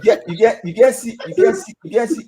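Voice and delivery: amused, rising teasing; excited laughing. Real, not acted. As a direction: push it up with glee. Laughing teasing about resemblance.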